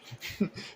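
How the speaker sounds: breathy burst of laughter; amused laugh. Real, not acted. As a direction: laugh at the joke. Laughs at own line.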